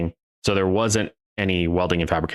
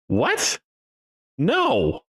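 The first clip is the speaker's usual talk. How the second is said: much higher, startled what and no. Retold or imitated. Boss taken aback by the joke, startled high. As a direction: jolt up in disbelief. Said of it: "sits back and goes".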